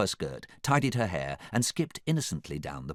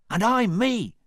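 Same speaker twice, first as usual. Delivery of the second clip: excited high, loud cry; Donkey; 7 dB louder than usual. A narrator's reading. Donkey identifies himself to Fiona, crying out high. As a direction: pipe up loudly and high. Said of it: "cried Donkey".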